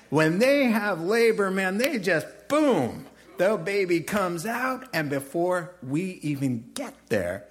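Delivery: high, loud, animated comic excuse; hammed-up explaining, pitched well above normal; excitable midwife excuse. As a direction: play up the astonishment, punching BOOM, then ease off. Acting midwives' excuse to Pharaoh.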